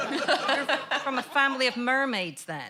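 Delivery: slow, laughing through the punchline; words broken by real giggles. Real, not acted. Waitress teasing a customer, laughing as she speaks.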